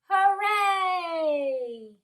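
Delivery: high, long-held hooray; villagers' cheer. A narrator's reading. Villagers cheer as whale swims free, drawn-out shout. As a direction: cheer it out, stretching the end. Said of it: "these are the villagers shouting".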